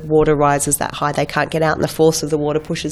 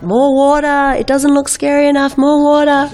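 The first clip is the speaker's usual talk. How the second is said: much higher, pushy mock director calling for more water; 10 semitones higher, 4 dB louder than usual. Director demanding more water from the stunt crew, hammed-up high call. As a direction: bark it like an impatient director. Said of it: "the director's like".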